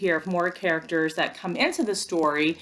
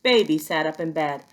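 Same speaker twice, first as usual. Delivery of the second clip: ordinary delivery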